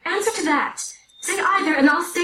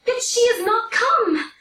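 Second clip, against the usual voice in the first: impatient; 10 semitones higher than usual. Acted. Juliet impatient for nurse.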